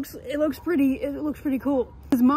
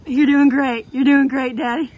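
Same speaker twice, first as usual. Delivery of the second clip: loud cheer; 10 dB louder than usual. Real, not acted. Sarcastic praise.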